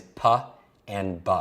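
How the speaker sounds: "P and B" as puh and buh; P and B sounds. Shown as a demonstration. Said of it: "Nine is the P and the B sound".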